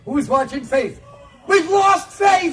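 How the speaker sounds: hammed-up lost-child panic; panicked yell. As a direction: cry out in mock alarm. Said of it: "yelling things like".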